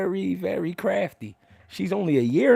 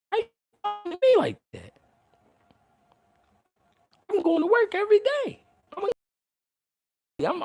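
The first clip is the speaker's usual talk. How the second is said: indignant retort. Retold or imitated.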